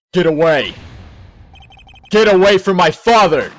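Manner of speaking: loud, desperate yell. Acted. Boy with a pistol defending his father, loud desperate yell. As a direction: shout to drive the attacker off.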